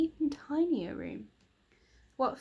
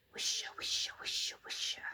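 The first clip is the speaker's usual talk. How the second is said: softer airy whisper of leaves; whispering trees; 6 dB quieter than usual. Acted. The trees of the magic wood whisper to each other, softly. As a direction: breathe it like rustling leaves. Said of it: "said the trees in the wood".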